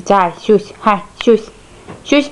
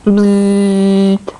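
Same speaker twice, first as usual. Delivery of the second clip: buzzing noise; 4 semitones lower than usual. Pretend. Mother plays with the baby.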